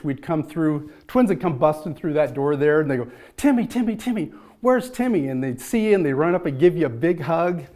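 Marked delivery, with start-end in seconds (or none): 3.4-5.3 put-on higher eager children calling a name; calling kids. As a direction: play the little twins hunting for Timmy. Twins running in calling.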